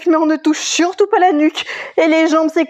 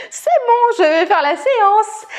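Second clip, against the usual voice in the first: viewer's eager reply; 6 semitones higher than usual. Retold or imitated. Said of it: "pour me dire".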